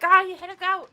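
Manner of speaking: high, loud mock yell. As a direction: call it out like an outraged onlooker. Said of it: "someone yelled out".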